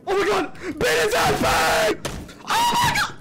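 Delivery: panicked screaming at the screen; panicking gamer. Real, not acted. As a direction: scream in real panic, pitch shooting up. Jump scare in horror game.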